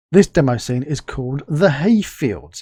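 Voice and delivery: usual speaking voice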